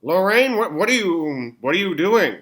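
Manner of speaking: high, alarmed outburst; shocked, rising voice. Acted. Shocked at gin bottle.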